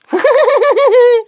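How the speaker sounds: high, loud burst of giggling; high giggle. Acted. Toy laughing at its own flower joke, high giggle. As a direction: crack up at the punchline.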